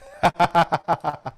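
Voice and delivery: high, loud burst of laughter. Real, not acted. Host reading a listener's snake confession, loud laugh. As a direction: crack up laughing.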